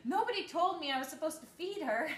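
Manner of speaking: mildly aghast, played for laughs; shocked new mom. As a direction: re-enact a startled protest. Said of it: "I gasped and said".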